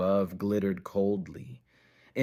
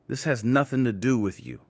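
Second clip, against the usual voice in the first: louder, higher, controlled but firm; steely firmness; 5 semitones higher, 6 dB louder, faster than usual. A narrator's reading. Nate warns Rick's men off, steely. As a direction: warn them off with steel. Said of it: "his voice calm but laced with steel".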